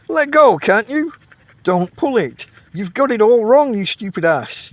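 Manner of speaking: high, loud angry shout. A narrator's reading. Camper shouts at his tentmate, angry. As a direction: yell at him across the tent. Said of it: "you shout".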